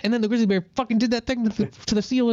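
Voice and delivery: high, fast, hammed-up wildlife narrator; overexcited nature-doc voice; nature documentary narrator. Retelling how he read the Planet Earth script, hammed-up narrator. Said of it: "I was reading as if I were David Attenborough".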